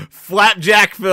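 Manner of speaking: slow loud nickname call. Real, not acted. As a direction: announce the nickname loudly. Mocking nickname shout.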